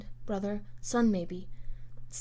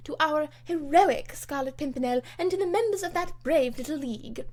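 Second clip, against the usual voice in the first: higher, louder angry rant; indignant tirade; 6 semitones higher, 5 dB louder than usual. Acted. Lady Portarles rails at Lord Grenville, loud and indignant. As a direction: denounce the sly spy.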